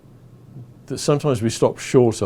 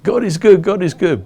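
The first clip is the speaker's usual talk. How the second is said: shouting praise; 8 semitones higher, 5 dB louder than usual. Retold or imitated. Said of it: "we're shouting".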